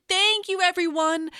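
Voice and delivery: high, loud thankful call; waving goodbye to friends above; happy shout. Acted. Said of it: "Hank waved and shouted".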